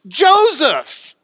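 "Joseph" loud and high, first syllable held; emphatic name. Shown as a demonstration. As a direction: proclaim "Joseph" as a dramatic headline. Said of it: "the next word of emphasis".

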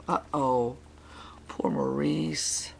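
soft whispered aside. Real, not acted. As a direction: breathe it out unvoiced. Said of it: "whispered aside".

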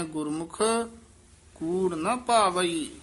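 short halt after Gurmukh, then on; pause after Gurmukh. Shown as a demonstration. Teacher demonstrating scripture reading, pausing after Gurmukh. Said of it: "ਗੁਰਮੁਖ ਪਦ ਤੇ ਯਮਕ ਲਾ ਦੇਣੀ".

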